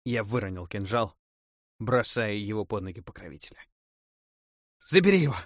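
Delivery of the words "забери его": loud and much higher, falling at the end; desperate shout. Acted. Necromancer begs the death god to take the dagger, shouting. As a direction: throw the dagger away with a cry.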